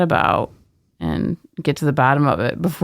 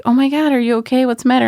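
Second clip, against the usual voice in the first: higher, quicker worried questions; mock alarmed concern; 9 semitones higher, faster than usual. Colleagues checking on a crying coworker, mock alarmed concern. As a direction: imitate startled colleagues fussing over someone upset.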